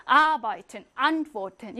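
first syllables of "Arbeiten," and "antworten" punched hard; "Arbeiten," and "antworten" slowly, with forceful first syllables; stress on first syllable. Shown as a demonstration. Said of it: "첫 음절에 강세를 빡 줍니다".

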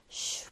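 "슈" as a soft, hushed sh; sh sound. Shown as a demonstration. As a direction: demonstrate "슈" quietly as the sh that starts chef. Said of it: "이 발음 기호가 이제".

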